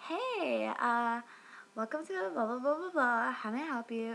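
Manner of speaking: mock customer-service singsong, trailing into blah-blah; Cindy. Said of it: "her name is Cindy, and she's like".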